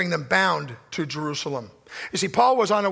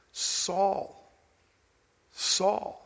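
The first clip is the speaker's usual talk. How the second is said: soft, hushed and gentle, almost breathed; tender, compassionate call; 6 dB quieter than usual. Shown as a demonstration. Preacher voicing Jesus calling Saul, softly tender. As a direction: call his name with love, not rebuke. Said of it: "the voice is filled with compassion and love".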